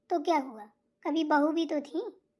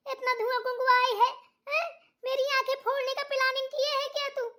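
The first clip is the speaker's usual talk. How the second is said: higher, nagging mother-in-law voice; scolding mother-in-law; 6 semitones higher than usual. Acted. Mother-in-law scolds bahu over the smoky stove, nagging and high. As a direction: scold the lazy daughter-in-law.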